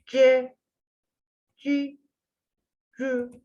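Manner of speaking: "C, ci, cü" with the tongue pressed; Arabic letter sounds. Shown as a demonstration. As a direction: say "C, ci, cü" carefully, syllable by syllable. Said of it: "Dil şımarık". ("C, ci, cü" harf harf)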